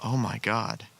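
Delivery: slow, hushed, dismayed; horrified inner thought. Retold or imitated. Remembering the dream in class, a slow hushed dread. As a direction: murmur it to yourself in dread.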